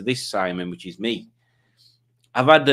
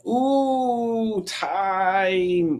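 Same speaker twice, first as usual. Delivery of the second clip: high, drawn-out "Ooh, time"; breathy wonder at seeing time; a hint of mystical awe; mock awed voice; 9 semitones higher than usual. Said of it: "like that".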